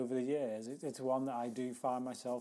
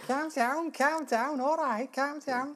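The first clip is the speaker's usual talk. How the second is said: very high, loud Scouse caricature; hammed-up Liverpudlian catchphrase; mock Scouse; 13 semitones higher, 7 dB louder than usual. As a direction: squeal it out like a comedy-sketch Scouser. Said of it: "all I can do is Liverpudlian".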